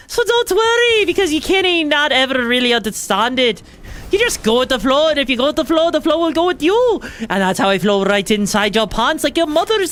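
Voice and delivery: trash-talk the enemy in a hammy Irish brogue; Irish accent. Pretend. Keeps the Irish voice taunting enemy.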